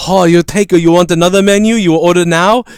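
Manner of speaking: put-on light Japanese accent, rising question; eager waiter asking in a Japanese accent. Waiter asking customer to order, mild Japanese accent. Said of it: "And he went".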